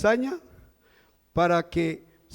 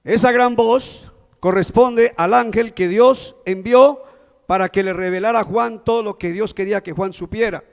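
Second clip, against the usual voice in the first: ordinary talk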